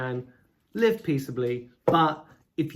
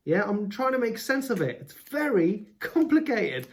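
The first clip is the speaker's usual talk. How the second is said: ordinary delivery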